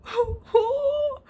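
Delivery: high, drawn-out mock-sobbing "oh"; exasperated wail. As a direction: wail in helpless disbelief. Exasperated wail answering the others.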